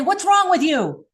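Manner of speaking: accusatory tone. Shown as a demonstration. Preacher demonstrating the accusing question to listeners, sharp and accusing. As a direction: fire the question at them like a charge. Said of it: "in that kind of accusatory tone".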